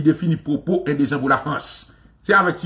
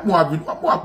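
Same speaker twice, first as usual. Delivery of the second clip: ordinary talk, nothing marked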